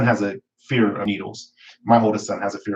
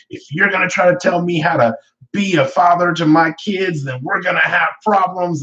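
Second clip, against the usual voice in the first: higher, heated retort; angry snap at mother; 8 semitones higher than usual. Retold or imitated. Father snapping at his mother mid-argument, heated. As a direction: bite back at your mother. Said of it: "And I'm like".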